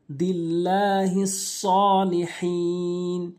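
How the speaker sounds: ordinary delivery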